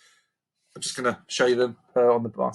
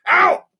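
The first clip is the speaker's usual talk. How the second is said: much higher, louder ow; pained yelp; 8 dB louder than usual. Acted. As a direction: yelp from an elbow in the belly. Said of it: "groaned Sniff".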